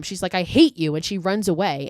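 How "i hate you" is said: put-on loud hurt yell of a teenage girl. Girl yells at her little brother after his prank. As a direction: shout it at him, stung and angry. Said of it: "And Jane yells at him".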